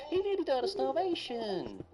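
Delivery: loud, high-pitched outburst; squawking in marital exasperation; exasperated. Acted. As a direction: protest it at the top of your voice. Acted marital quarrel.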